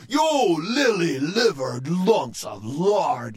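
scornful pirate scolding; lashing out. A narrator's reading. Captain Joe lashing out at his cowardly crew. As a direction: spit the insult at cowards. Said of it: "lashed out Dirty Joe".

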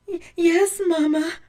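high, loud sobbed reply with catching breath; sobbing. A narrator's reading. Blanche answers her mother, sobbing. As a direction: stammer it through tears.

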